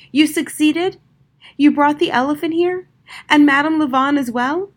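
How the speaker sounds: loud, high, amazed questions; can hardly believe it; astonished. A narrator's reading. Magician astonished at news.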